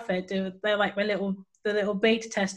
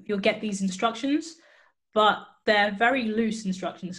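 ordinary delivery

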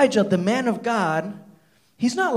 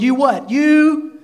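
louder, higher accusing shout; angry accusation; 6 semitones higher, 4 dB louder, slower than usual. Retold or imitated. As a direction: hurl the insult at him. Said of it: "Ahab exclaimed".

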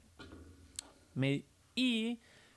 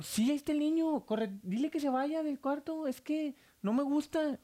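high-pitched, insistent pleading; frightened sister; 7 semitones higher than usual. Retold or imitated. Sister begging her brother to make the boy leave, frightened. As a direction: imitate a distressed patient in a high voice.

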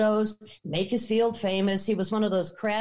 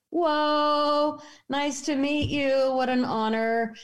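higher-pitched, drawn-out awe; retold starstruck reaction, pitched up; awed greeting; 7 semitones higher, slower than usual. Visitor meeting the Archdruidess of Stonehenge, awed.

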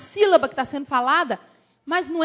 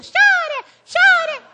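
very high, squeaky child imitation; disciple's little child; 5 dB louder than usual. Disciple's little child copying the prayer, high mock-child voice. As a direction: squeal it like a tiny copycat. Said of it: "Tinha até filhinho de discípula".

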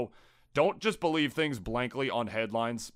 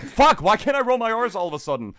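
frustrated shout; 10 semitones higher, 9 dB louder than usual. Real, not acted. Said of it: "frustrated at failed trill".

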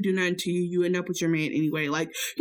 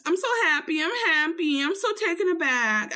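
higher squeaky-sweet put-on voice for Hoops; 8 semitones higher than usual. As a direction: play her giddy and gushing in a higher voice. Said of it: "I've never used this voice for her".

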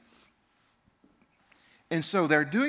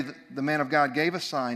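ordinary delivery

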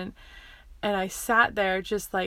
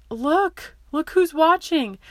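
higher, louder imitation of an urging mother; urgent mom, retold; 8 semitones higher, 6 dB louder, slower than usual. Mom to child kicking on the grass, retold urgent plea. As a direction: act out a mom's pointed plea, pitched up.

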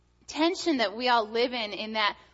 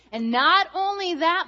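put-on excited little boy; 9 semitones higher, 8 dB louder than usual. Son voiced in story.

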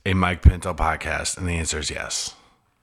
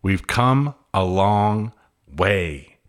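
slow, savoring each word; drawn out; much slower than usual. Acted. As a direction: stretch the line out slowly. Repeats phrase, then drags it out.

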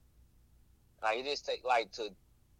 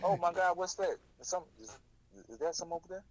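playing dumb, pointing away; fake distracted; 5 semitones higher than usual. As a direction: pretend to spot something.

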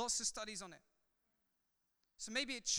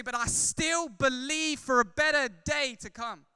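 loud, driving sermon delivery, louder; full-force preaching; 7 semitones higher, 10 dB louder than usual. Acted. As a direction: preach it out to the whole room.

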